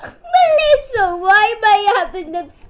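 high shrieking laugh-yell; laughing shout. Real, not acted. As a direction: yell her name through laughter. Laughing outburst at sister.

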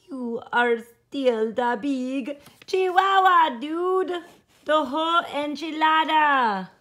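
a light hammed-up Spanish accent, loud. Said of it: "using his very best Spanish accent".

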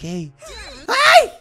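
sudden high, loud yelp; startled yelp. Real, not acted. Gamer startled by Purpur in the pipes, startled yelp. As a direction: yelp as if caught by a jump scare. Startled by game jump scare.

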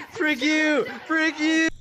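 high, loud curse; heated taunt. Real, not acted. Cursing a player following him, heated shout. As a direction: yell it at the pursuer.

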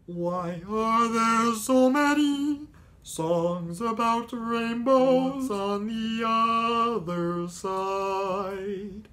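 high, slow, reedy frog voice; Kermit the Frog. Retold or imitated. Kermit singing about rainbows, high and slow. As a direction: sing it wistfully as a frog puppet. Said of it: "Kermit the Frog".

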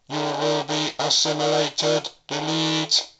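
slow, loud, heavily distorted robotic monotone; Cyberman threat. Shown as a demonstration. Hobbyist showing extra distortion to viewers, slow distorted Cyberman threat. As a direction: menace like a machine. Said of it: "you will get this effect".

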